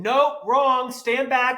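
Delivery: high, projected coaching call. Retold or imitated. Speech coach in the back row correcting him, called out. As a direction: call the notes up to the stage from the back seats. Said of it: "a speech coach in seat eight hundred and a megaphone".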